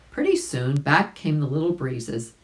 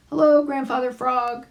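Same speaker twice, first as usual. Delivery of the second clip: high character voice; 10 semitones higher, 4 dB louder than usual. Acted. Billy Mink greets the frog.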